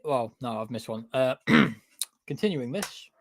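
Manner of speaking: ordinary delivery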